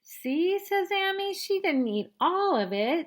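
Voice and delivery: high, loud coaxing; big sister pushing her to share; bossy big sister. Acted.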